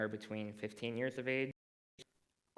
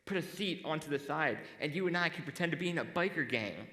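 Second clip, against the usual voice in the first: raised, enthusiastic pitch of a fun plan; eager suggestion; 6 semitones higher, 4 dB louder than usual. Retold or imitated. Friend proposing a shared Halloween costume, eagerly. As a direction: imitate a friend thrilled by their own idea. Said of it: "the ideas were".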